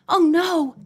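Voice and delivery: a mildly emphatic refusal; objecting. A narrator's reading. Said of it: "Nancy objected".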